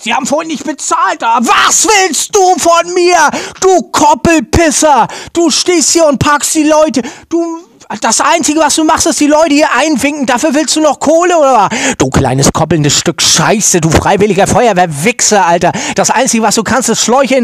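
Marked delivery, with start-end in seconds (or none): 0.0-11.7 angry shout. Acted. Yelling at a parking attendant.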